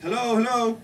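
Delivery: re-enacted call to see who's home. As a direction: call into an empty apartment. Calling further into the apartment.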